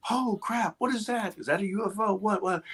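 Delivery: high, hushed cries of astonishment; softly squeaked disbelief; acted startled crowd. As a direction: imitate a stunned crowd, pitch up, volume down.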